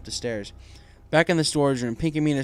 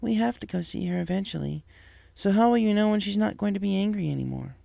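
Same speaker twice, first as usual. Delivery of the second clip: higher mother voice, pointed and doubtful; Firefly, skeptical; 8 semitones higher than usual. Acted. Wife questioning her husband, skeptical. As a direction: question him like a disbelieving wife.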